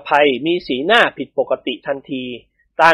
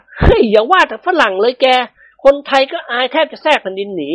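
higher, lively male character; laughing-it-off friend; 8 semitones higher than usual. A narrator's reading. Man answers his teasing friend, lively amused protest. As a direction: exclaim to a pal in a raised voice.

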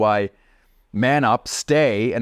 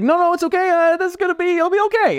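much higher, a bit louder nervous guide; jittery reassurance; 4 dB louder, faster than usual. Retold or imitated. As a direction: fake calm while panicking. Guide reassuring while fleeing.

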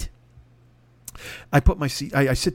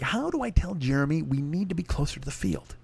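much softer, close-in murmur; hushed confiding; 9 dB quieter than usual. Retold or imitated. Pardo complains quietly to the comic, much softer.

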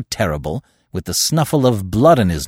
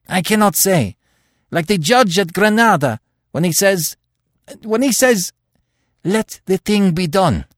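high, loud, distraught halting speech; agitated dread with broken pauses; horrified; 12 semitones higher, 6 dB louder than usual. A narrator's reading. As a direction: struggle to explain, pitch held high. Horrified Terry struggles to explain.

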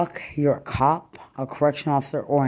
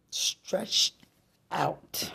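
hissed whisper of the insult; 4 semitones higher, 5 dB quieter than usual. Real, not acted. Ranting at an ex, whispering the insult. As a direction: breathe it out as a whisper.

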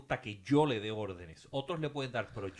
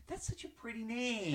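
much higher, slow, sugary mock compliment; mock sweet gushing; 12 semitones higher, 4 dB quieter, slower than usual. Mocking strangers who botch her name, syrupy high.

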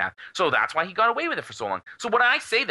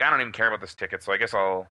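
indifferent mumble; 8 semitones lower than usual. Retold or imitated. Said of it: "you're just like".

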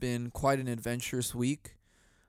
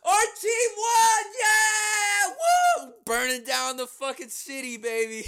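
much higher, louder whooping cheer; rowdy celebrating fan; 5 dB louder, slower than usual. Pretend. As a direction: whoop like a fan burning the city. Acting out celebrating Philly fans.

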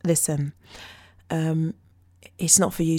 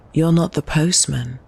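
louder spoken-word delivery, stress on "not"; poem line; 2 semitones lower, 5 dB louder than usual. Shown as a demonstration. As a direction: read "You're not the postman" as a louder accusation, hitting "not". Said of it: "it's called".

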